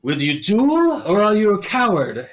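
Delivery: high, loud theatrical challenge; duel challenge. Retold or imitated. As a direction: throw down the gauntlet, hammed up. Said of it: "He goes".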